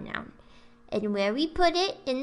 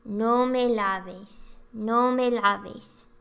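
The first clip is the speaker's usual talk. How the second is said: slow demo; slower than usual. Shown as a demonstration. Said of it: "opposite ending".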